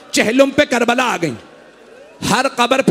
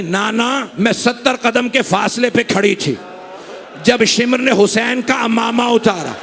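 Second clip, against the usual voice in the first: ordinary talk, nothing marked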